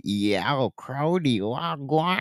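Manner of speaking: exaggerated Toronto slang imitation; mocking the fake Toronto slang. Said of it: "twist up their mouth and talk".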